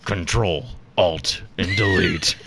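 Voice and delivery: slow devilish punchline; Satan voice. Acted. Satan naming the missing keys, gloating. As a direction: spell it out with evil relish, slowly. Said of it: "Good Satan voice".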